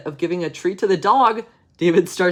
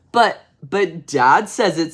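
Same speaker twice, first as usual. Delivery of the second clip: put-on slow, louder kid's excuse; protesting child; 3 semitones higher, 6 dB louder, slower than usual. David blames Dad for the word, drawn-out mild protest. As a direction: stretch it out as a lightly voiced protesting boy.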